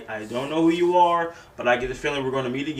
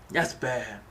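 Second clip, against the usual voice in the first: usual speaking voice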